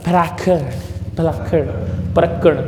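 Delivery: परागकण in a put-on English accent; mock English accent with short, anglicised vowels. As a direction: imitate an English foreigner fumbling Hindi. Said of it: "जैसे अंग्रेज होते हैं ना".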